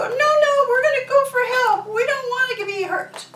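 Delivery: high, fast panicky frog voice fading to a whisper; frightened frogs. Acted. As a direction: squeak in panic, then whisper.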